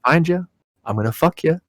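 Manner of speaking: lightly voiced menacing film villain; a hint of a demon stalking his prey; Willem Dafoe. Demon coming down the rows after his victim, light Willem Dafoe voice. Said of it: "say it in that Willem Dafoe voice".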